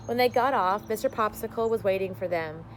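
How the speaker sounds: ordinary delivery, nothing marked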